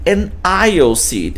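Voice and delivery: I would like an aisle seat, slow and careful, aisle as one syllable; slow teacher demo; slow model sentence. Shown as a demonstration. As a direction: model it slowly for the class. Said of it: "vou pedir".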